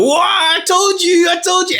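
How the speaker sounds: reliving the moment he snapped at the class; retold outburst. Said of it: "I just go off on a mad one".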